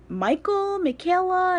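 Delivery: high, questioning mispronunciations; imitating teachers' wrong names. Teachers misreading her name, high and unsure. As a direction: say them like a puzzled teacher. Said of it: "they would say".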